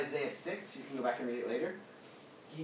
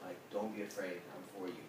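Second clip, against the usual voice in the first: lower, softer, casual comforting; gentle reassurance; 7 semitones lower, 9 dB quieter, faster than usual. Acted. God to Daniel waking up afraid, gentle reassurance. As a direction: soothe him quietly, like a friend. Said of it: "he's like".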